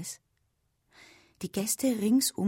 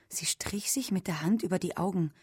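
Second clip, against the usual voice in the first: usual speaking voice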